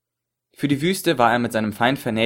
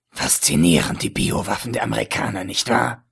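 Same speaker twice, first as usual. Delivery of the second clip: gloating whispered villain. A narrator's reading. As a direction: whisper it with cruel delight. Ägypter, genüsslich. (genüsslich geflüsterter Schurke)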